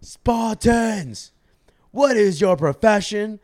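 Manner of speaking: high, loud commander's shout; big strong voice. Retold or imitated. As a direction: roar it out to rally your warriors. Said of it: "he says with a big, strong voice".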